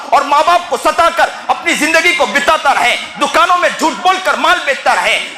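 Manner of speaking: high, insistent sermon in driving repeated phrases; fervent preaching. Real, not acted. Preacher warns the heedless man of death, fervent preaching.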